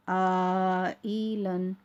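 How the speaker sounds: "आ ई लन" on a level tone, each syllable held long; separated syllables. Shown as a demonstration. Teacher reading a word for learners, syllable by syllable. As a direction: stretch "आ", then "ई", then close on "लन". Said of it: "अलग-अलग करके पढ़ रही हूँ".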